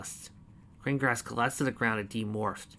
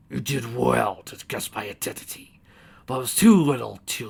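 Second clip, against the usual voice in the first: villain goddess voice; 6 dB louder than usual. Acted. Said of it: "Corona complimented".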